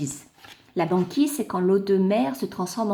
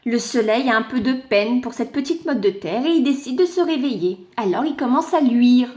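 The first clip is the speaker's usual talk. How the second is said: ordinary delivery, nothing marked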